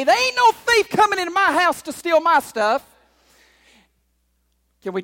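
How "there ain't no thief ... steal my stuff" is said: firm, louder, defiant; defiant declaration. Retold or imitated. Homeowner refusing a thief, defiant and louder. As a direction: plant your feet and refuse the thief. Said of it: "take some action and say".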